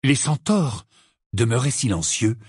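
ordinary delivery, nothing marked

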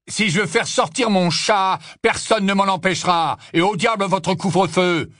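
angry barman, high and insistent; defiant barman. A narrator's reading. Barman defying the Death Eaters' curfew, angrily. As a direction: defy them as the indignant barman.

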